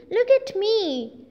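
high, slow call of "Look at me"; shouting. A narrator's reading. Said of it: "He shouts".

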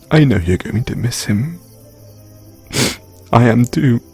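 ordinary talk, nothing marked

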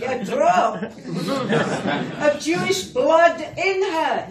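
mock a Scottish tweeter, half-hearted; Scottish accent. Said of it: "I can't do the Scottish accent properly".

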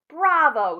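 loud, high bravo; hearty acted cheer; delighted cheer. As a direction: burst out in approval. Father cheers the worm recipe.

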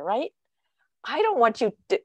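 ordinary talk, nothing marked

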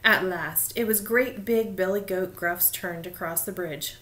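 ordinary delivery, nothing marked

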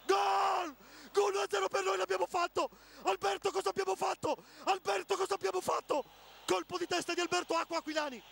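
ecstatic screaming goal call, very high and loud; goal celebration shout. Real, not acted. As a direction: scream it like your team just scored. Home commentator celebrating a goal.

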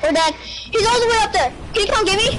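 high urgent calling out; frantic cries for help; panicked. Real, not acted. Knocked down, panicking.

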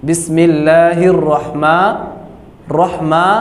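slow, stretched রহমা with rounded ro; teacher repeats the rounded র in রহমা; correct pronunciation. Shown as a demonstration. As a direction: draw it out, saying রহমা not রাহমা. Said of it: "উচ্চারণ করতে হবে".

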